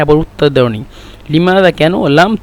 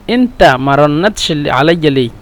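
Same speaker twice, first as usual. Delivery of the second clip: ordinary talk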